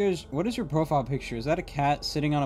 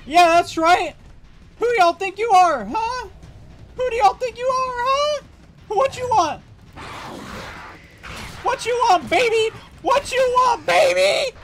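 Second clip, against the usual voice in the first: much higher and louder, yelling challenges; shrieking alien taunts; 7 dB louder than usual. Acted. Challenging the other squad mid-fight, shrill shouting alien. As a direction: scream taunts at the enemy squad like a crazed alien.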